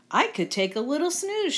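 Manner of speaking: put-on high, lilting little-girl storybook voice; Goldilocks. Goldilocks helping herself to a seat, high storybook voice. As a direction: pitch it up, pleased to help herself. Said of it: "she said".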